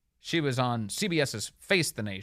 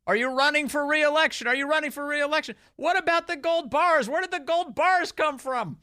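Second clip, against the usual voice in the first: high, loud hammed-up shouted questions; mock reporter shouts; 12 semitones higher, 4 dB louder than usual. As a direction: hound him with questions as he leaves. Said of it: "they shout out things like".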